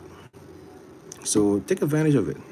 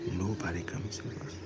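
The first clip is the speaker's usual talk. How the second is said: softer, hushed like a secret; whisper; 3 semitones lower, 13 dB quieter than usual. Shown as a demonstration. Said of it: "you gotta say it slow".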